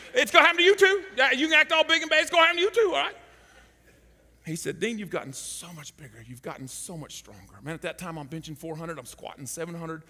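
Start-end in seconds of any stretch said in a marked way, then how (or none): 0.1-3.2 louder, higher teasing; mock threat to the audience; playful warning. Real, not acted. Teasing the congregation. 4.8-7.6 soft fatherly voice; gentle and proud, as a dad; much softer tender praise. Retold or imitated. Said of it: "kinda like a father now".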